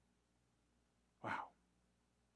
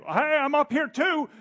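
high, louder playful voice for the program; mock computer voice; 12 semitones higher, 9 dB louder than usual. Preacher voices his buggy program, higher and louder. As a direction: give the glitch a cheeky voice. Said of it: "it would say".

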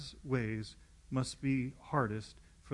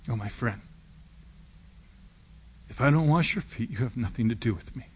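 urgent plea; 7 dB louder than usual. Acted. Jesus answering Peter.

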